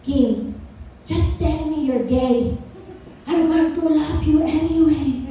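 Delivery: imitating an insistent mom; mom impression. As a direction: put on mom's voice, drawing the words out. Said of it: "she would come up to me".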